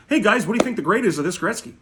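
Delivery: high, eager mock newbie voice; mock eager poster. Mocking posters asking for grades, high eager voice. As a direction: parody a begging online poster. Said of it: "Stop posting cards on the fucking internet and say".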